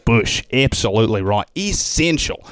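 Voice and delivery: broad Aussie accent; emphatic Australian bush guide. Shown as a demonstration. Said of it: "You wanna go to Australia".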